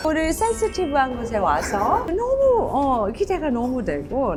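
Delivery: gushing wife impression, slow; Kang Ju-eun impression. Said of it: "강주은님".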